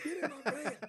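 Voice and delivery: high, loud mock shout; creepy van man. Comic jokes about living in his scary van, mock creepy shout. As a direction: play the creep calling someone into a van.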